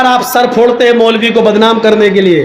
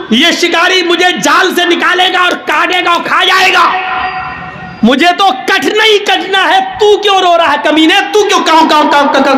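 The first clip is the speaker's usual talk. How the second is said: angry pigeon; 7 semitones higher than usual. Acted. Pigeon berating the crow in the hunter's net, exasperated shouting. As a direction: yell at him in indignant frustration.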